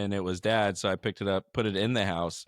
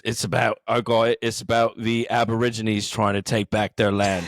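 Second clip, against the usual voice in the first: lower, firm London-accent impression of Jason Statham; a touch louder, mock London hardman delivery; 4 semitones lower, 3 dB louder than usual.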